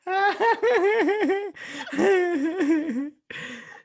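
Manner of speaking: long high shrieking laugh; howling laugh. Real, not acted. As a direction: crack up completely. Huge laugh after joke.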